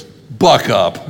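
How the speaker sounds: a gruff, loud, no-nonsense "buck up"; a hint of a tough burn survivor brushing off complaints; blunt friend. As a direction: bark it like a tough old friend. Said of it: "he goes".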